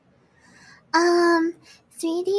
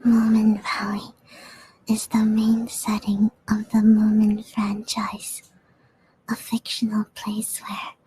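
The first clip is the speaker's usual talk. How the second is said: ASMR whisper; 6 semitones lower than usual. Acted. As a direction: whisper the text gently.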